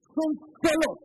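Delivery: loud, high cry on solos; emphatic cry. Real, not acted. Pastor crying out to his congregation, loud and high. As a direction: hit solos high and hard.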